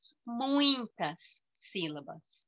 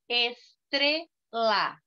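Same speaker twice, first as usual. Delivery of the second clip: "estrela" broken slowly into es, tre, la; slow three-beat "estrela" for counting; syllable by syllable; much slower than usual. Shown as a demonstration. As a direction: pause after each syllable of "estrela". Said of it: "três sílabas".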